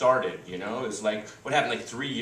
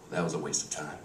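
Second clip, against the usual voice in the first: low, soft, deflated imitation of wife; disappointed mutter; 10 semitones lower, 9 dB quieter, faster than usual. Wife grumbles to husband after sex, deflated. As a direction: sigh it out, unimpressed. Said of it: "gives this, like, sigh".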